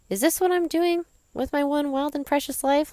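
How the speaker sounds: mock-exasperated question to yourself, voice lifted high; self-questioning disbelief. Questioning herself, acting out her inner voice.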